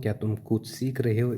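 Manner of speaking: ordinary delivery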